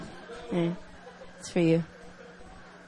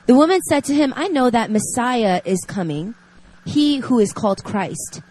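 reading scripture; 4 dB louder than usual. Acted. Reads scripture passage aloud.

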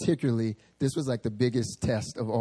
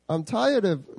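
bad New Zealand accent; 7 semitones higher, 4 dB louder, slower than usual. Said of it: "I can't do a New Zealand accent, so I'm just gonna do whatever comes to mind".

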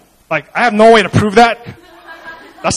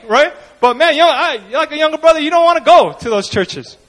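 ordinary talk

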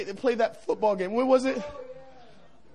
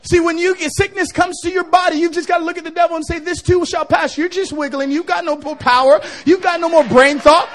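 loud rising sermon cry; preaching at full force; 3 semitones higher, 7 dB louder than usual. Acted. Pastor rallying the sick to face down the devil, loud. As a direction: drive it home to the crowd.

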